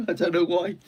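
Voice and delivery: laughing through high Welsh voice; Welsh accent, laughing. Acted. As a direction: giggle it in the Welsh voice. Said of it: "proper Welsh".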